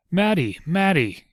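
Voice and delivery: a father overjoyed to see his daughter; excited cry of her name; joyful cry. Acted. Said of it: "he cried".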